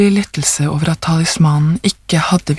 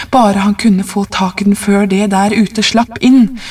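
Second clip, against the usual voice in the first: usual speaking voice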